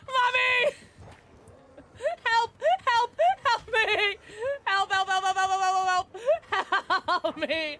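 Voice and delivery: high, loud hammed-up cries for help; mock terror. Streamer being chased, hammed-up screaming for help. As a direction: wail for mommy in playful fake fear. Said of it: "I'm so scared".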